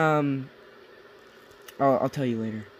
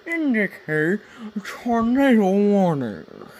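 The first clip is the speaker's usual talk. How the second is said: slow, higher, louder TV announcer read; mock newscaster; 4 semitones higher, 3 dB louder, slower than usual. Podcast host jokingly reading a tornado warning, mock broadcast voice. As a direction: play a hammed-up weather-alert anchor. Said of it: "This is my sportscaster".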